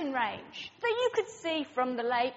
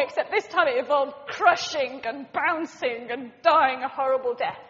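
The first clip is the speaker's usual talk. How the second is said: ordinary talk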